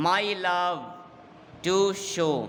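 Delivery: "my love", pause, "to show", each drawn out; divided into feet. Shown as a demonstration. Teacher counting out feet, "my love" and "to show" said separately. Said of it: "এইভাবে ভাগ করলে দেখা যাবে ছটা".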